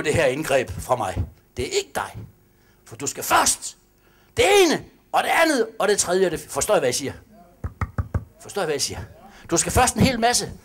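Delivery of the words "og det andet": normal talking voice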